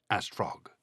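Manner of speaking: ordinary delivery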